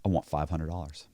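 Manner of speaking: low, soft retold demand; name the price low and understated; quiet demand. Friend naming his fee after a wait, low quiet voice.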